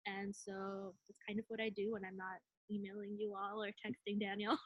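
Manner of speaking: ordinary talk, nothing marked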